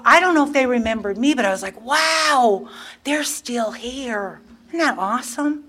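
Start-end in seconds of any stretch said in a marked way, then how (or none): 1.8-5.6 high, drawn-out wonder; amazed. Retold or imitated. Newcomer on seeing Rachel and the others still there, amazed. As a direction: relive the awe with raised pitch.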